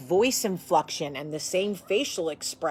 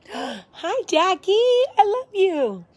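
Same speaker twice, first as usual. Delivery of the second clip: mock sweet greeting; 12 semitones higher, 6 dB louder, slower than usual. Sweet talking to the camera.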